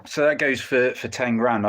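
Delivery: ordinary talk, nothing marked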